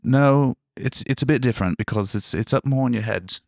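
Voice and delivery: London accent placed up in the head. Shown as a demonstration. Correcting a friend who heard Australian, London accent. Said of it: "a London accent that I can do".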